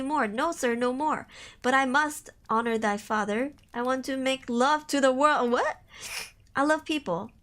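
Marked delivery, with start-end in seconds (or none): none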